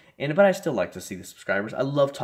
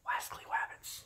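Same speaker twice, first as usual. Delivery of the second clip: soft unvoiced whisper; 11 dB quieter, slower than usual. Real, not acted. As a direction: whisper so nobody wakes. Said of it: "I don't wanna wake them up".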